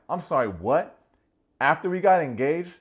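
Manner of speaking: loud, high mock outrage; outraged disbelief. Nick hearing she'd tell him after filming, loud outrage. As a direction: act stunned and outraged.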